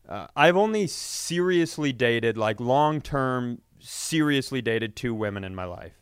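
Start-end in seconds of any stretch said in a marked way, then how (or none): none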